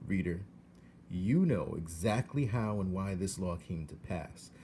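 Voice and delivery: ordinary delivery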